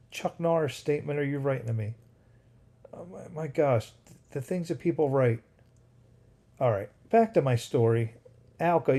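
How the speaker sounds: normal talking voice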